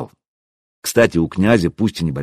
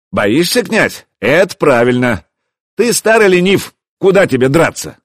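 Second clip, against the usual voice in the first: raised, higher jeer; taunting call; 6 semitones higher, 3 dB louder than usual. A narrator's reading. Hero mocking the old prince, taunting. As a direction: goad him so all can hear.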